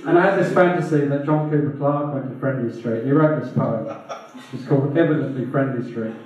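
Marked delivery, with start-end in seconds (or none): none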